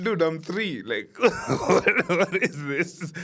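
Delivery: high, laughing mock protest as a kid. As a short kid, complaining about being measured against a cousin, laughing. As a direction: giggle through the complaint. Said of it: "I'm like".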